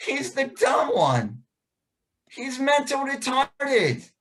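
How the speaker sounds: lightly voiced classmates jeering; mocking kids. Classmates mocking the boy to the teacher, slow taunt.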